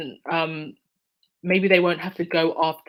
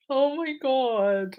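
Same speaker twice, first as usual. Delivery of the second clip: higher, slow tearful sigh; 5 semitones higher, much slower than usual. Real, not acted. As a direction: breathe it out through tears. Crying over donation.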